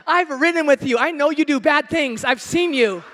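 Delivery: ordinary delivery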